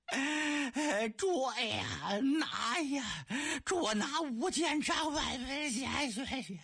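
high stretched yell with a crying edge; bellowed shout. Acted. Soldiers shouting yet again for the chess players, high stretched yell. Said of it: "再喊一遍".

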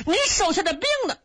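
alarmed, high-pitched asking; urgent question. A narrator's reading. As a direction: demand news of the missing troops. Brothers urgently ask about lost troops.

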